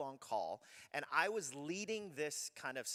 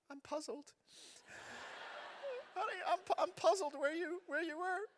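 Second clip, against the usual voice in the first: puzzled, gentle; 14 semitones higher than usual. Shown as a demonstration. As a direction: show the calm, non-judging way. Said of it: "instead we say".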